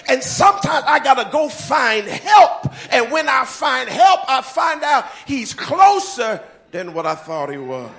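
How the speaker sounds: loud, rising pastor's cry building to shouts; full-force preaching. Acted. Pastor rousing the congregation about finding God, shouting. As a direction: preach it out big to the whole room.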